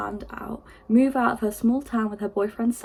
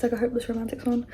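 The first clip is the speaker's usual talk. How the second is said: normal talking voice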